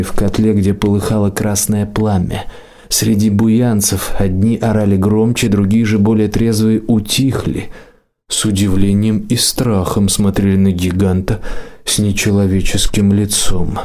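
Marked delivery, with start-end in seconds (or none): none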